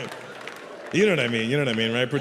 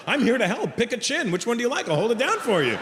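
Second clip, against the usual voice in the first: much higher, pleading eagerness; eager helpful patient; 8 semitones higher than usual. Retold or imitated. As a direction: push it higher, desperately helpful.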